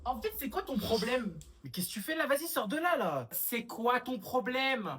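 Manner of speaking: high, loud scolding shout; angry confrontation. Acted. Sister storms in on her brother, angry shouting.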